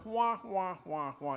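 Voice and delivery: muffled trombone-like wah wah of the Peanuts adults; adult talk like this. Retold or imitated. Pastor mimicking the Peanuts adults to the congregation, mock wah-wah. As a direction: imitate the unintelligible grown-ups. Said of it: "the adults always talk like this".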